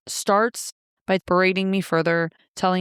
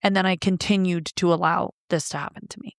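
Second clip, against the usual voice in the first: normal talking voice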